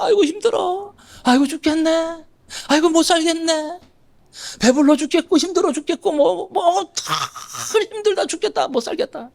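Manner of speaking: high whiny moaning of a chronic complainer; habitual complainer. Retold or imitated. Preacher mimics a churchgoer who habitually moans. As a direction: moan every line like a tired grumbler. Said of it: "어떤 사람은 습관적으로".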